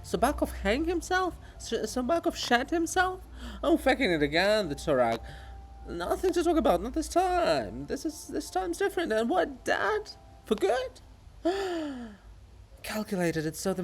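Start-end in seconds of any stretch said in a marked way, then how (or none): 0.0-11.0 high, eager chattering voices; excited gossip. Acted. 11.3-12.1 sharp gasp of shock. Acted.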